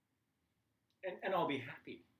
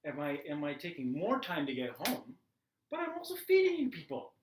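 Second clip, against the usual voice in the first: indignant rant. Retold or imitated. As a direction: let the grievance swell to a peak.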